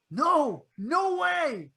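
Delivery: too spooked to look; scared refusal. Retold or imitated.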